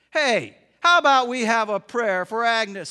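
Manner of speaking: loud call to the room; rally everyone to pray. Acted. Tony calls the silent room.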